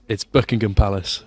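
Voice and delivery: "Buckingham Palace" in a Brummie accent, loud; Birmingham pronunciation. Shown as a demonstration. Birmingham student asked to pronounce it, Brummie accent. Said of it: "and pronounce it".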